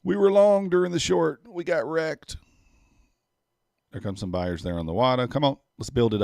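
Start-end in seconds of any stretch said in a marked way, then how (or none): 0.0-2.4 loud, high burst of excitement; excited outburst. Real, not acted. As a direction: blurt it out, thrilled. Trader excited at market move.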